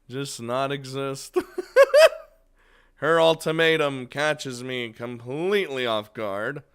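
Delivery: ordinary talk, nothing marked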